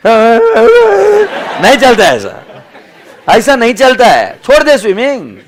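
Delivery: high, loud hammed-up exasperation; mock scolding shout. Coach telling a whining swimmer to give up, mock scolding shout. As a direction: yell it like a fed-up coach.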